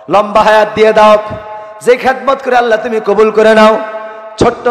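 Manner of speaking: high, loud chanted supplication; fervent prayer. Real, not acted. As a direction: call out to Allah before the congregation.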